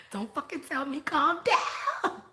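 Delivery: high-pitched protest breaking into laughter; mock outburst. Woman who hates being told calm down, laughing mock outburst. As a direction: squeal it in mock indignation, laughing.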